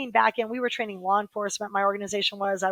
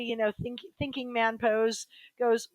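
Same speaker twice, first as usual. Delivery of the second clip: usual speaking voice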